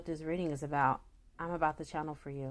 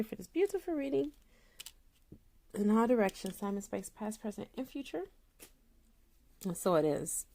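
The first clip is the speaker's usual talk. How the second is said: invoke each element like a prayer; spiritual invocation; 3 semitones higher than usual. Acted.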